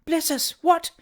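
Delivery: put-on high-pitched old lady's startled question; alarmed exclamation. A narrator's reading. Old lady alarmed by the fat boy, exclaiming high. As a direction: exclaim as a flustered old woman. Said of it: "exclaimed the old lady".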